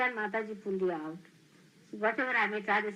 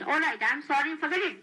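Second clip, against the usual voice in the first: higher, louder, brushing it off; breezy dismissal; 6 semitones higher, 5 dB louder, faster than usual. Shown as a demonstration. Teacher showing disciples a casual sorry, higher and louder. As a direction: toss it away lightly. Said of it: "But you do not say like this".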